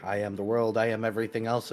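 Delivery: ordinary talk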